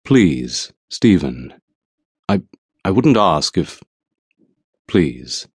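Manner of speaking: a touch of a shaky plea; halting, pleading, breaking off; voice shaking. Acted. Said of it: "My voice shook as I said".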